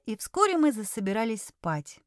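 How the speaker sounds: ordinary delivery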